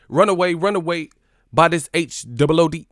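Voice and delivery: loud, high Cali rap chant. Acted. Putting Cali on it, loud rapper impression. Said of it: "say it like [name]".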